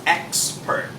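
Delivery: expert with a crisp ks, throat closed. Shown as a demonstration. Teacher modelling expert with the x as ks. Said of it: "you have to close your throat".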